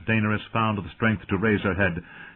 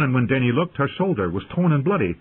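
ordinary delivery, nothing marked

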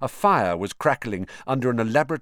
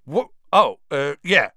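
high, startled stammer; flustered surprise; 5 semitones higher, 7 dB louder than usual. A narrator's reading. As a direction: caught off guard, fumbling. Ron caught off guard.

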